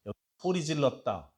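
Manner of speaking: normal talking voice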